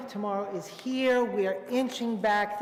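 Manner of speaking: ordinary delivery, nothing marked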